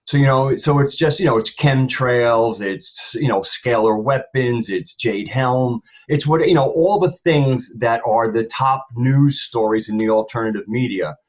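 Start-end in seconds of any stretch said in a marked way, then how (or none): none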